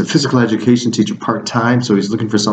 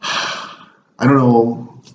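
weary sigh; 3 semitones higher, much slower than usual. Real, not acted. Exasperated sigh after meeting.

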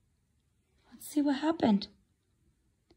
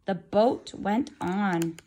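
ordinary delivery

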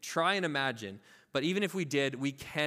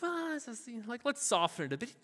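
high-pitched, hedging mock excuse; justifying; 5 semitones higher, 4 dB quieter than usual. Believer softens Jesus's hard saying, acted wheedling justification. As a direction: talk your way nervously round it, acting. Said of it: "I wanna justify it to be like".

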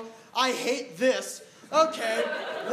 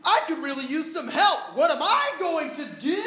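high, loud, slow despairing cry; hammed-up panic; 4 semitones higher, 3 dB louder, slower than usual. Comic stranded onstage yelling for help, loud and slow. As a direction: wail to the crowd in mock desperation.

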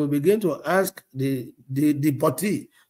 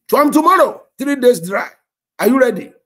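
high, loud charge to fast; rally her with a firm order; loud high call to commit; loud command; 7 semitones higher, 10 dB louder than usual. Retold or imitated. Pastor commands a fast, retold.

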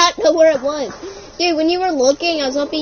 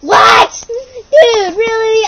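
mock distress; 7 semitones higher, 8 dB louder, much slower than usual. Mock hurt outcry.

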